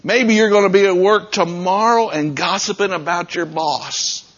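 ordinary talk, nothing marked

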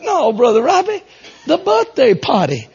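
high, exasperated mock Northeastern accent. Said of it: "heavy accented women".

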